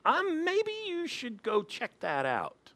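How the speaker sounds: high, soft, hesitant suggestion; tentative onlooker. Acted. Pastor voices onlooker's thought to driver, tentative. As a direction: gently float advice in a small high voice.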